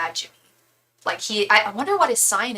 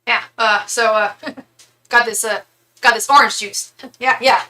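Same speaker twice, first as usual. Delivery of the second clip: a hint of a dopey Leno, louder, broken by laughs; lightly voiced, rambling mock talk-show host; mocking Leno as unfunny, laughing through it; Jay Leno impression; 7 dB louder than usual. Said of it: "this is my impression of him".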